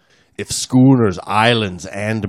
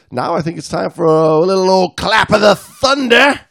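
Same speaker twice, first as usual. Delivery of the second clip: pirate voice; 9 semitones higher, 4 dB louder than usual. Pretend. Pirate talk.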